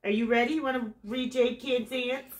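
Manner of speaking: usual speaking voice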